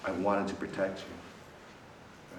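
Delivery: mom's tender voice. Retold or imitated. Preacher voicing his mom's protective love, soft and slow. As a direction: say it gently, like a protective mother. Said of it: "But my mom's will was".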